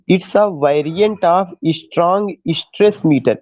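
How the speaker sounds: very slow, word by word, for note-taking; slow dictation. Shown as a demonstration. Teacher dictating to students taking notes, very slow. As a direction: spell it out so students can write.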